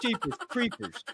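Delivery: jeepers creepers. Real, not acted. After laughing fit.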